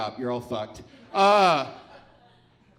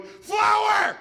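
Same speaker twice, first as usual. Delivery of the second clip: frantic high shout; high frantic yelling; panicked shouting; 7 dB louder, slower than usual. Pretend. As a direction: yell like a tiny panicked clerk. Small man panicking in brain.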